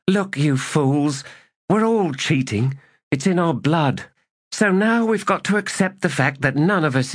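high character voice, scolding; exasperated sergeant. Acted. Sergeant scolds squabbling dice players, exasperated. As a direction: lay it out for a pack of fools.

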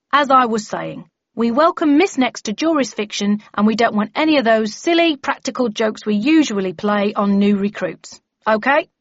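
resume business with a stern warning; bellman warning members. Acted.

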